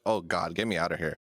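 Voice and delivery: normal talking voice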